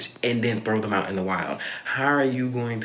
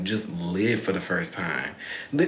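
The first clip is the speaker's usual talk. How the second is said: ordinary talk, nothing marked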